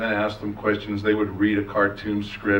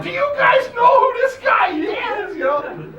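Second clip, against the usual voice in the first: excited fan squeal; 4 dB louder than usual. Retold or imitated. Fan screaming to friends.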